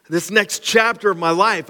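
ordinary talk

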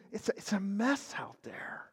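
a sudden whisper, voice dropping away; whispered aside. Real, not acted. Pastor to viewers, confiding in a real whisper. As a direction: let it slip out under your breath. Pastor stressing how bad things are.

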